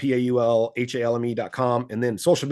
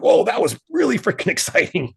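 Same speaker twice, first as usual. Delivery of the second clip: higher-pitched mock amazement with a laugh; excited fan reaction; 7 semitones higher, 4 dB louder than usual. Imitating a fan after a spinning back kick, excitedly. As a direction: play a wowed spectator, half laughing.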